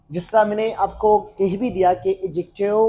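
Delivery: ordinary talk, nothing marked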